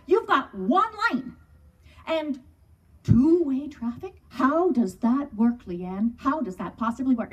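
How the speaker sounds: slow, incredulous imitation of a newcomer; hammed-up disbelief, slow and emphatic; mock bafflement. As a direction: stretch out the key words as if it makes no sense. Voicing baffled city people.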